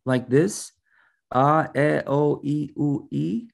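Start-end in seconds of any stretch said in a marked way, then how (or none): 1.3-3.5 A, E, O, I, U as pure Spanish ah, eh, oh, ee, oo, higher pitched; higher, questioning Spanish-vowel recital of A, E, O, I, U. A narrator's reading. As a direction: say A, E, O, I, U as separate Spanish vowels, rising at the end. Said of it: "pronounce them as if they were in Spanish words".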